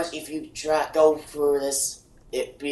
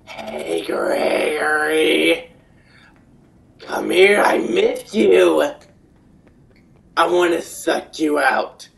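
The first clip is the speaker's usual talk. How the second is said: menacing animatronic voice; 9 dB louder, slower than usual. Acted. Animatronic calling to Gregory.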